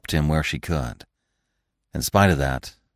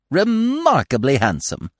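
high, slow, emphatic praise; admiring squirrel cry; 9 semitones higher than usual. A narrator's reading. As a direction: relish each syllable of the compliment. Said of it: "cried the squirrel".

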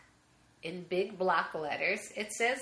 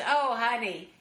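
higher and louder, building indignation; ex-wife ranting about the cheating husband, higher and louder; getting worked up; 7 semitones higher, 4 dB louder than usual. Retold or imitated. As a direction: let the ex-wife's grievance boil over. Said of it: "she starts getting all worked up".

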